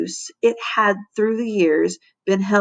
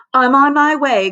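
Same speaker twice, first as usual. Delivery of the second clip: raised, higher call; cry out; 7 semitones higher, 5 dB louder than usual. A narrator's reading. Lunch monitor answering a call for help, crying out. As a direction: cry it out, pitched up and louder. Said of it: "cried Missus Bunns".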